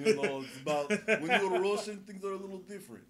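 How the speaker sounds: a hint of a Russian spy; Russian accent. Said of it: "That's a good Russian voice".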